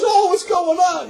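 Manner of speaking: high, slow alarmed nun's cry; mock alarmed nun. Nun bursting in on the fight, high alarmed voice.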